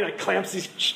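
normal talking voice

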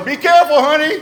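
high, loud, timid warning; hammed-up fretful husband; mock worried call. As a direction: call out anxiously from a safe distance. Calls to wife from the deck.

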